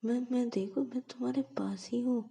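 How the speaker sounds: high, anxious rush of comfort; startled and worried, trying to soothe her; alarmed concern. Acted. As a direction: friend panics seeing her cry, pitched high and anxious. Ananya sees Payal crying.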